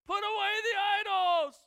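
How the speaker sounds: high, loud shout; loud command. Retold or imitated. Joshua orders the people to drop idols, shouting. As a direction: call it out across the crowd.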